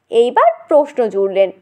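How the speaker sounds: usual speaking voice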